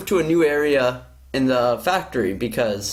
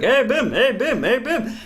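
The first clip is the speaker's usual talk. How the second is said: nagging coworker; 7 semitones higher than usual. Retold or imitated. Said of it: "he's like".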